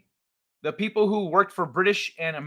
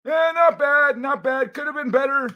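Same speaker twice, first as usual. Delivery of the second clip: put-on judge your own accent in a sniffy critic's voice; mock critic; 9 semitones higher than usual. Streamer grading his own accent, mock sniffy critic. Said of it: "How was that?".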